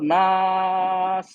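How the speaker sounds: नास with the aa stretched very long, ending in an airy s; four-count elongation. Shown as a demonstration. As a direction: hold the aa of नास steadily for four beats. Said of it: "चार हरकत खींच के".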